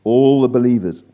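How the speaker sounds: loud, deliberate scripture reading; slow reading. Real, not acted. Leader reading the passage to the group, slowly and loudly. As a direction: read it out slowly and firmly. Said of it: "let me read it slowly".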